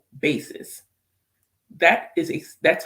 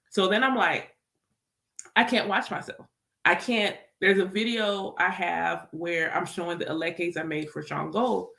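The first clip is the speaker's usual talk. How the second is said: normal talking voice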